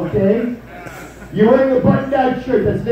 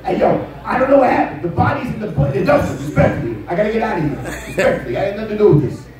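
lightly put-on New York street accent, half laughing; a hint of a Brooklyn suspect pleading innocence; Law and Order extra. Imitating Lex as a Law and Order extra, lightly New York. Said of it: "sounds like the extra from every episode of Law and Order".

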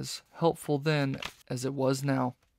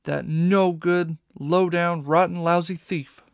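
slow, loud, spitting each insult; angry outburst; 4 semitones higher, 7 dB louder than usual. A narrator's reading. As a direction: bite off every word in fury. Carmelita furious at Sly.

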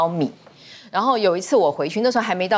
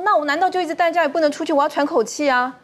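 exasperated retort; 8 semitones higher than usual. Retold or imitated. 母亲反驳.

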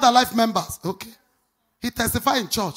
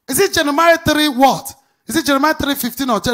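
louder and higher, building; preaching at full force; 5 semitones higher, 8 dB louder than usual. Acted. Pastor urging the church to find their pastor, building to a loud climax. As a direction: rise to a pulpit climax.

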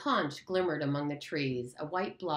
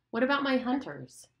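pressing, higher the second time; pushier, raised repeat; insistent Jack; 9 semitones higher, 3 dB louder than usual. Acted. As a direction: demand an answer. Jack repeats his question, pressing.

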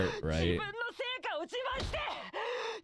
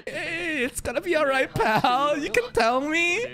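mock consoling; 9 semitones higher, slower than usual. Playing consoling pal to character.

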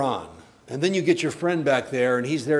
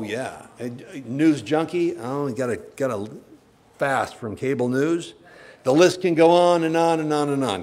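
ordinary talk, nothing marked

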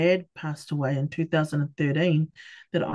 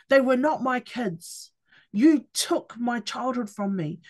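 higher, slow, aching cry of grief; reliving a tearful release of anger at mum; retold wailing outburst; 6 semitones higher, slower than usual. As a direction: let the hurt pour out, slowly and high. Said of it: "I just started wailing".

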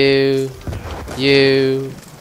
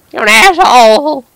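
louder, higher, slow hammed-up insult; mock angry insult; 10 semitones higher, 12 dB louder than usual. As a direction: drag out the insult in mock rage at the killer. Insulting the player who killed him.

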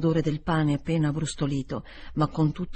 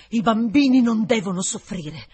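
louder, pressed home; with emphasis; 4 semitones higher, 8 dB louder than usual. A narrator's reading. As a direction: insist firmly on the children. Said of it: "ribatté con enfasi". (con enfasi, più forte e deciso)

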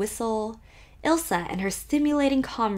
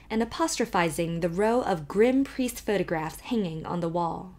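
plain reading voice

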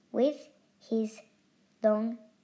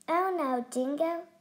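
higher, fluttery emu voice; a hint of a fluttering emu; 6 semitones higher than usual. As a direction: flutter the words up high. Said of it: "she fluttered".

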